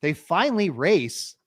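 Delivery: ordinary delivery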